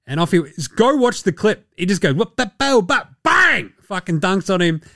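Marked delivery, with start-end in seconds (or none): 2.1-3.7 high, punchy mock impact noises; imitated dunk noises. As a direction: build to a high, explosive bang.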